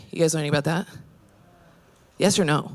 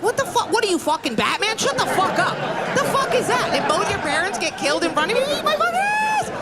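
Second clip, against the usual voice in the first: mock rant; 11 semitones higher than usual. Ranting at avoidants.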